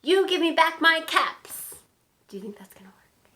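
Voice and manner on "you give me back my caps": loud, higher-pitched storybook scolding; scolding peddler. A narrator's reading. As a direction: demand it like a cross peddler. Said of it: "shaking a finger at them".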